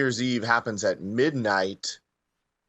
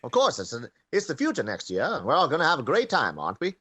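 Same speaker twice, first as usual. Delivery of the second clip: light vintage radio announcer voice; a hint of a cheery old-time announcer; old-timey announcer; 4 semitones higher than usual. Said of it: "I bet you do a good old-timey announcer guy".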